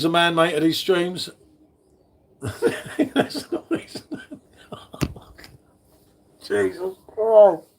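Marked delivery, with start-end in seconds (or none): 2.4-7.7 slow, high giggle through the words; laughing. Real, not acted. Reading a silly chat name, laughing it out. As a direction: dissolve into laughter.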